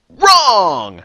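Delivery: loud, high, booming "wrong!"; game-show verdict. Acted. Host tells contestant Mike his answer is out, loud dramatic reveal. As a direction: belt out the verdict like a quizmaster.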